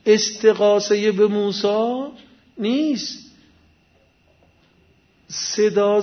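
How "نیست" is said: usual speaking voice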